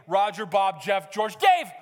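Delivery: flustered roll-call bursting out on the last name; exasperated parent. Retold or imitated. Parent hunting for the right child's name, exasperated shout. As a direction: run through names, building to a shout.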